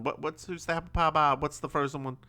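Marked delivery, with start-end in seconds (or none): none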